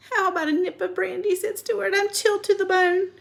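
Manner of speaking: lightly voiced Stuart; small boy voice. A narrator's reading. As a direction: pipe it up like a tiny chilled boy.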